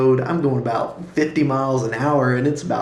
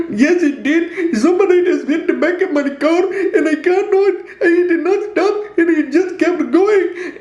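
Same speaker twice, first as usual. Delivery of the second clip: upset Indian woman; 4 dB louder than usual. Retold or imitated. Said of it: "this Indian woman who's standing outside the car".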